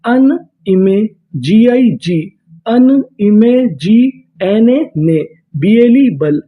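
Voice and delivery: slow, syllable-by-syllable spelling out; slow sounding out. Shown as a demonstration. Teacher to students, sounding out the word slowly. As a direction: build the word slowly, piece by piece. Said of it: "वो भी एक ही मात्रा का काम करेगा".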